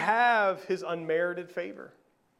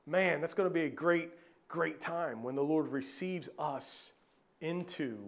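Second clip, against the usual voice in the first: ordinary talk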